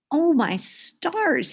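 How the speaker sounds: startled leprechaun. Acted. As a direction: jump with fright as the leprechaun.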